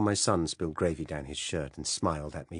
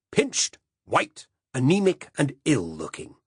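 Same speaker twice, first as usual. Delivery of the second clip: much higher, louder mock disgust; scornful definition; 8 semitones higher, 7 dB louder than usual. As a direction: spit out each word with contempt. Silas defining peaky.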